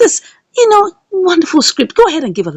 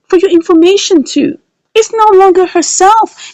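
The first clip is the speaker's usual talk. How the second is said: higher and louder mock challenge; defiant boast; 11 semitones higher, 5 dB louder than usual. Flora defiantly boasts to the mother, loud. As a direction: throw it at her like a dare.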